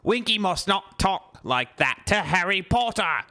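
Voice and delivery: high squeaky elf, a touch of scolding; mildly cross house-elf reprimand; Dobby scolding Winky for rudeness, squeaky; angry Dobby. Acted. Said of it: "said Dobby angrily".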